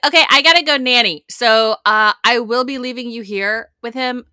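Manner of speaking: high, loud, rushed bossy mom voice; hammed-up frantic new mom; mocking Emily. Mother mocking the nanny handoff, loud and high. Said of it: "So she's like".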